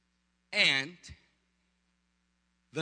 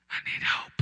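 voiceless mock plea for help; desperate whisper. Husband begging pastor by phone, desperate stage whisper. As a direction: whisper it like a man in trouble.

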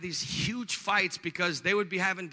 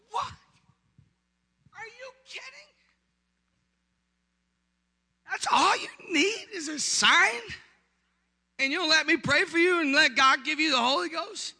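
very high-pitched disbelief, growing louder; retold incredulity; 10 semitones higher, 5 dB louder than usual. Pastor to weeping wife at breakfast, high incredulous voice. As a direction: start tiny and squeaky, build to a loud plea. Pastor reacts to her plea.

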